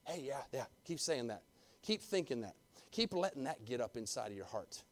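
soft, low devil coaxing in the ear; soft low tempting murmur; devil whispering. Acted. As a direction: whisper it like a tempter at your shoulder. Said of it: "whisper in your ear".

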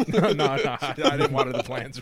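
laughing. Real, not acted. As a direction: deny it while cracking up. Denying through laughter.

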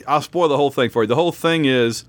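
usual speaking voice

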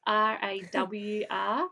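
slow, deliberate letters; spelled out slowly. Shown as a demonstration. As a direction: spell it out one letter at a time. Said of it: "I'll spell that out too".

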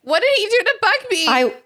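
high, loud wail of outrage; upset, high-pitched shouted question; distressed outcry. Real, not acted. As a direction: cry out in alarm, voice shooting up. Upset at hearing Buckbeak was hurt.

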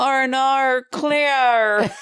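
"R and R, clear" high and loud, Aussie cartoon kid; Australian accent. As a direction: mimic the kids' Australian mermaid show. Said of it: "they would always go".

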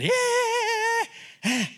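long, high-pitched "yeah" squeal; mock yelling. Man complaining about noisy churches, mock yell. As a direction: imitate a noisy congregation with a drawn-out high cry. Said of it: "yelling, shouting, clapping hands".

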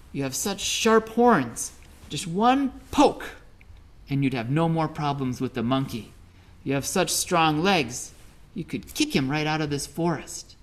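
a sprite egging on the ox; forest sprite scolding. Acted. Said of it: "the sprite went to the ox and said".

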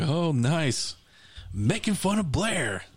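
high, slow, mock unimpressed imitation; imagined sister's remark. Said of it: "she'll probably be like".

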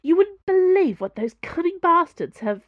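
loud, high, furious outburst; acted indignant anger, loud; angry. A narrator's reading. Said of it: "Special Agent Cho said angrily".

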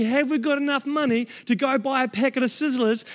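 normal talking voice

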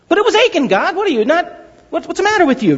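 high, indignant whine at God; mock complaint, pitched high; mock protest. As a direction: play the complainer objecting to God.

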